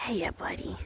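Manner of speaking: soft whisper to a goat. Real, not acted. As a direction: whisper it gently to the animal. Petting goat.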